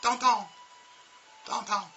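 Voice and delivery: teacher drills a strong ow in "Downtown"; strong ow sound. Shown as a demonstration. Teacher to class, pushing a high, loud "Downtown". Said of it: "I want them to have a strong ow sound".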